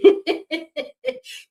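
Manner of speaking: short, high laugh rising; high rising chuckle; real high laugh. Real, not acted. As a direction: laugh at your own emphasis. Laughs after the repeat.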